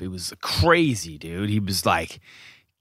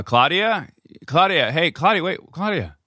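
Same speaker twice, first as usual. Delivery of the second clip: high, raised call across the house; 7 semitones higher than usual. Retold or imitated. Wife calling for the nanny as she walks in, raised call. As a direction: call the name up the stairs, searching.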